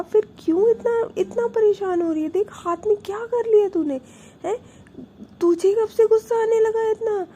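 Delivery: high, loud scolding; alarmed scolding over her hurt hand. Retold or imitated. Older sister scolds her sister loudly for hurting her hand.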